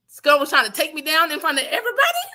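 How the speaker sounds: embarrassed disbelief. Retold or imitated.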